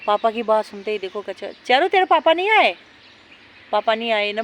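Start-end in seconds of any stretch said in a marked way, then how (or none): 1.6-2.8 high teasing pet voice. Real, not acted. Asks dog about papa.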